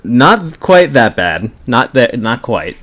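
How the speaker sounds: slow, loud laughing reply. Real, not acted. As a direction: laugh through the correction. Laughing correction.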